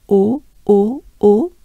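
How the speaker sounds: tight rounded O; closed O. Shown as a demonstration. Teacher showing the closed French O. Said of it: "pretty closed up sound".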